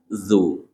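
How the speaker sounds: Zu with the tongue tip against the upper front teeth; zu sound. Shown as a demonstration. Teacher isolating the syllable, careful demo. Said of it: "ujung lidah dengan ujung gigi seri atas".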